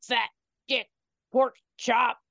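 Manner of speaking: mock possessed-kid voice, word by word; kid's demon impression. Podcaster quoting the possessed boy's insult, mock demon voice. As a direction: imitate a child faking possession, each word set apart. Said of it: "At one point he said".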